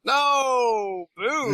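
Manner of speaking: hammed-up outburst; mock outcry. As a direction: cry it out in playful mock horror. Joking reaction to a crude pun.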